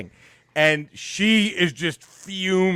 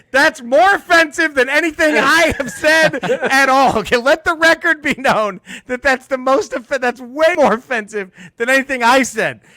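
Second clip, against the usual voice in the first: high, loud outraged retort; indignant comeback; 8 semitones higher, 4 dB louder than usual. Retold or imitated. Comedian retorts to the old man, high indignant protest. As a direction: fire it back at him, incredulous and high.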